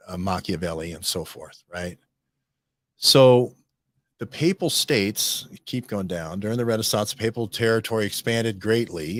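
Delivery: normal talking voice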